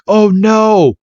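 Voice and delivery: high-pitched dramatic commentary; hammed-up spectator dismay; alarmed crowd. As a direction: call it out in mock alarm, climbing to the finish. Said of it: "the whole crowd's like".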